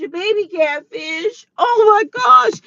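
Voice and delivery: tearful disbelief rising to a high wail; crying. Real, not acted. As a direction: start slow and tearful, then cry out. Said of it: "I'm sitting up here crying".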